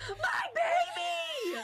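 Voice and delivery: shrieked very high and loud, half crying; overjoyed squeal. Retold or imitated. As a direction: squeal it like finding a lost treasure. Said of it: "and she goes".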